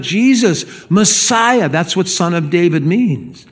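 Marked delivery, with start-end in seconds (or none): 0.0-1.7 high, pleading cry to Jesus. Acted. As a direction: call Jesus Messiah, pitched high. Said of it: "So he cries out".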